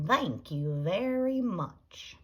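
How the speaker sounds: slow, drawn-out thanks; drawn-out customer thanks. A narrator's reading. Leroy serving customers at the concession stand, slow and drawn out. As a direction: stretch the thanks out slowly.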